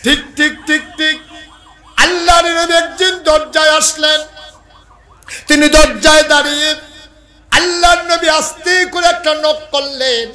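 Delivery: loud, high-pitched sermon cry; high, ringing preacher's shout; full-force preaching. Acted. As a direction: belt out the dialogue at the top of the voice.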